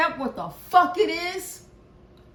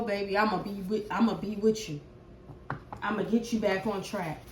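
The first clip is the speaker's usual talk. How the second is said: ordinary talk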